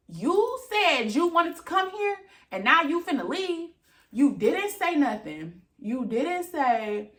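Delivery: indignant scolding of the absent friend; angry rant. Real, not acted. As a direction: let her have it, voice up and sharp. Ranting at the friend who left.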